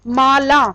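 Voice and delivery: Mala with extra weight on ला; stress on ला. Shown as a demonstration. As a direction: press on the second syllable, ला, of Mala. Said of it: "ला के ऊपर हम ज्यादा pressure दे रहे हैं". (माला में ला पर बल)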